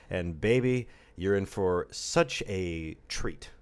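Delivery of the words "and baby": normal talking voice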